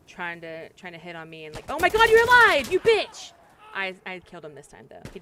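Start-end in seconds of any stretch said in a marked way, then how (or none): 1.7-3.3 high, very loud real yell; real high-pitched outburst; startled shout. Real, not acted. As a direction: burst out shouting in shocked outrage. Bug turns out alive.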